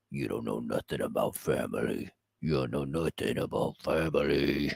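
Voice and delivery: very low, hushed put-on voice; mock action hero, deep and quiet; Vin Diesel's character. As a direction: drop way down into a soft, deep movie-hero parody. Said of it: "to do the Indiana Jones thing to, uh, Vin Diesel's character".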